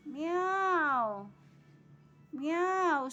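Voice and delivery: high, drawn-out mock meow; playful long kitten cry with a falling tune; imitated cat meow. Meows to coax louder kitten cries.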